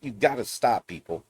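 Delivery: usual speaking voice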